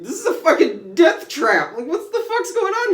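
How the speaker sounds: very high, loud panic; panicked cursing. Retold or imitated. Rogue panicking in the trap, high and loud. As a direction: freak out.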